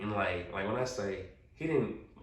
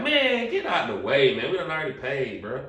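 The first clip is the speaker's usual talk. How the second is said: annoyed brush-off; 5 semitones higher, 7 dB louder than usual. Retold or imitated. Pushing past guy at club.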